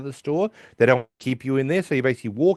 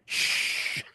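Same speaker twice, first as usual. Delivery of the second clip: long, soft hushing sound; drawn-out mock-secretive shh; conspiratorial shush; 6 dB quieter than usual. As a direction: hush them as if guarding a secret. Hushing after confiding a silly secret.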